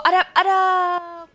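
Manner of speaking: slow, drawn-out call through laughter; giggling yell at the bomber; laughing shout. Real, not acted. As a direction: yell the name while cracking up. Yelling while laughing, bomb nearby.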